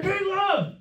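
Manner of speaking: high, loud mock yell of King Love; mock gang yell. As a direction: shout it like a street-corner gang member. Gangbanger yells at her.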